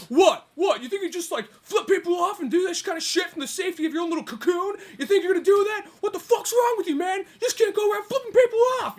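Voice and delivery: high, loud mounting rant; mock an outraged driver telling someone off; imitated angry tirade.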